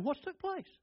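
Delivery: high, soft, wondering question; king Hezekiah asking. Acted. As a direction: voice the puzzled king. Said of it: "imagine Hezekiah saying".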